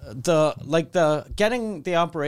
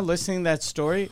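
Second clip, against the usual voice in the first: normal talking voice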